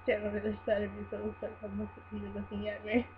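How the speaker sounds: plain reading voice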